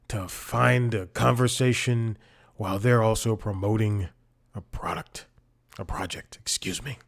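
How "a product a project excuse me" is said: soft breathy whisper of a famous politician; whispered mock RFK impression. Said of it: "I'm trying out my new R F K voice".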